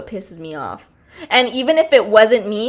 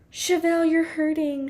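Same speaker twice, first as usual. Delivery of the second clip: mock concerned friend; 5 semitones higher, 6 dB quieter than usual. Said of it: "when people are always like".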